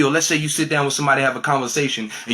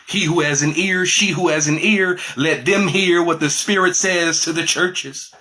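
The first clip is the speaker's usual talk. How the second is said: raised, declaiming preacher delivery; scripture proclamation; 6 semitones higher, 3 dB louder than usual. Acted. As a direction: proclaim the verse, drawing out the ending.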